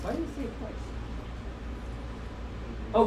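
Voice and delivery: ordinary delivery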